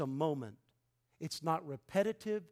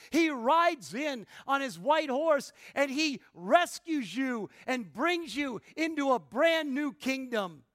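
higher, ringing pulpit proclamation; forceful preaching; 6 semitones higher, 6 dB louder than usual. Real, not acted. Preacher telling believers they are under Christ, forceful preaching. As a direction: proclaim the rescue with punched key words.

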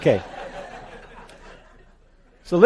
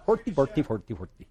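mock Swedish-chef gibberish; jokey Muppet chef babble. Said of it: "that puppet on the Muppets".

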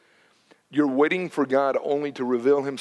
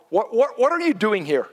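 pressing the question; 6 semitones higher than usual. Real, not acted. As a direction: drive the question home at the crowd. Preacher pressing the congregation.

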